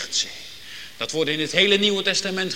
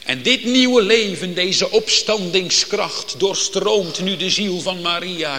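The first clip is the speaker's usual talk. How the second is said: krachtig preken; 3 semitones higher, 6 dB louder than usual. Acted. Preacher builds with full force.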